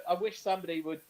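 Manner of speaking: usual speaking voice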